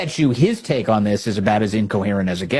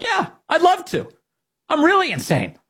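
much higher, gleeful mock socialist. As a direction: squeal it like a giddy guest. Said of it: "they come on and they're like".

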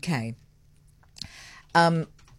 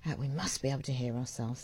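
softer, whispered side remark; whispered aside; 5 semitones lower, 11 dB quieter than usual. Real, not acted. Host whispering a studio aside to listeners. Aside about hearing herself on-air.

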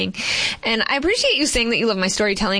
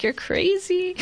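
parents scoffing; 11 semitones higher, slower than usual. Retold or imitated. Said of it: "my parents were like".

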